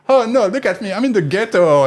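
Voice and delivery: high, loud posh mock lament. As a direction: play the snooty rich tourist. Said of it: "talking like a posh person".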